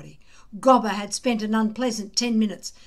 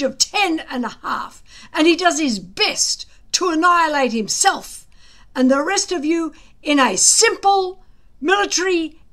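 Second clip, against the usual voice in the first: sarcastic tirade; 6 semitones higher, 7 dB louder than usual. A narrator's reading. Gobber berating his future chief's son, loud sarcastic rant. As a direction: rail at him with heavy sarcasm.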